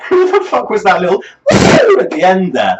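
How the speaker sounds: laughing in disbelief; incredulous laugh. Real, not acted. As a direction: laugh out the question, baffled. Baffled reaction to song.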